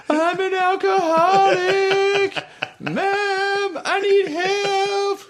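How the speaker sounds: high, slow whiny sob-voice; mock wailing. Teen crying in bed to his mom, hammed-up wail. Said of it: "crying like a fucking banshee".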